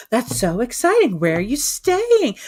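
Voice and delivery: high, loud, gushing squeal of interest; imitated obsessed excitement. Bank manager hears of Vegas wedding, obsessed excitement. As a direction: mimic someone thrilled, stretching the last question. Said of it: "like, obsessed".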